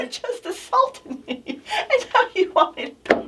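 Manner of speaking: high, laughing through the words; giggling while telling the story. Real, not acted. Laughing as she tells the co-host about the aloe lady.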